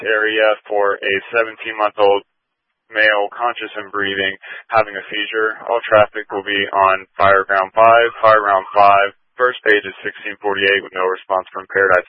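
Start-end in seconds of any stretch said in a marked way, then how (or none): none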